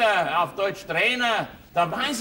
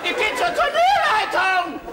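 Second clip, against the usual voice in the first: much higher and louder, fast, hammed-up whine; mock indignant woman; 4 dB louder than usual. Offended tennis mother threatening to complain to tournament officials, mocking squeal. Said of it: "dann hat sie sich beschwert".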